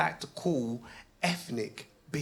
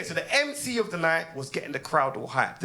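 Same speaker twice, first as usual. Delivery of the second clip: ordinary talk, nothing marked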